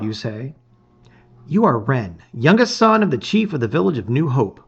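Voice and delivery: measured storybook narration voice; a reader opening a gamebook, narrating; story narration. A narrator's reading. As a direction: read it like setting the scene of an adventure. Narration resumes.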